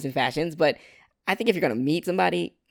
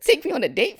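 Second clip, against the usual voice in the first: playful button voice, louder; voicing the button; 10 semitones higher, 3 dB louder than usual. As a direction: pretend to be the slapped button. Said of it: "I'd be like".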